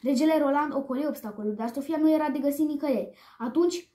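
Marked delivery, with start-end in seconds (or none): none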